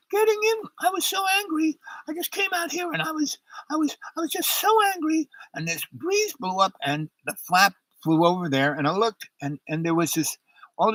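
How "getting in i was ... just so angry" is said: put-on very high, whispery falsetto of a drunk wife. Drunk wife apologising from the lifeboat, in a very high falsetto. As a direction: coo it high and breathless, full of apology.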